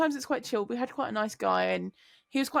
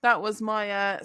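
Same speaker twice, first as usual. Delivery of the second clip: ordinary delivery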